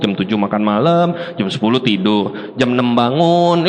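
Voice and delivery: low, slow droning list; monotonous routine. Acted. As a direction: recite a dull daily routine in a low drone. Acting out monotonous village routine.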